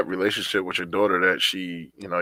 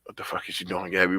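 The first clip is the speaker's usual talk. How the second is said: much softer, muttered to himself; inner thought; 3 semitones lower, 8 dB quieter than usual. Retold or imitated. Dad's unspoken reaction to his daughter, quiet aside. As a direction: keep it under your breath, a private thought. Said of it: "I be thinking that in my head".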